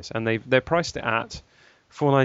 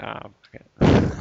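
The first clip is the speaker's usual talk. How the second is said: slow whispered shrug; 2 semitones lower, 6 dB louder, slower than usual. Retold or imitated. Imitating dismissive company.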